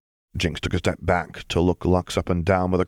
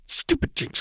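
hissed self-reproach; 4 dB quieter than usual. Acted. Punching herself in rage.

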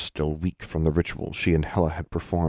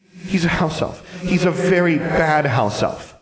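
put-on high, slow, emphatic child voice; tattling ghost girl; 12 semitones higher, 4 dB louder than usual. Ghost girl complaining about Quimley, high slow child tattling.

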